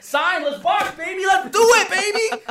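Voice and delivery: high, loud, hyped-up goading; shouted challenge. Real, not acted. Goading his rival into a boxing match, shouting. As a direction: shout it as a fired-up dare.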